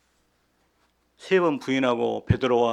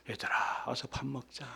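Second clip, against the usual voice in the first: gentle call; 9 dB quieter than usual. Pretend. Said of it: "생선 숯불 구이를 마련해 놓고".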